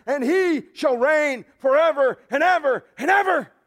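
preacher echoes Handel's chorus in a loud, high shout; triumphant proclamation. Acted. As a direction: ring it out like the Messiah chorus. Climax quoting Handel's Messiah.

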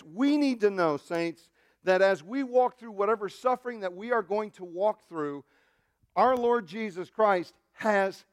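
high, emphatic pulpit exhortation; preaching at full force. Real, not acted. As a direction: drive the assurance home to the whole congregation.

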